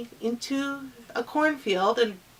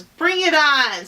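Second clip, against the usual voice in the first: loud, high defiant cry; defiant challenge; 7 semitones higher, 6 dB louder than usual. Acted. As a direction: throw the challenge straight back. Turtle accepts the challenge.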